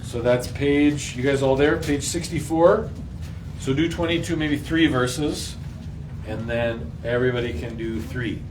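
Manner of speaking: ordinary talk, nothing marked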